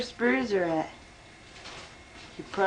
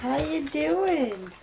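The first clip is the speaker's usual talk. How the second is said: higher, slow pet greeting; greeting the dog; 7 semitones higher, slower than usual. Real, not acted. Filming the dog and sweetly greeting it, slow and high. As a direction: sweet-talk the dog slowly.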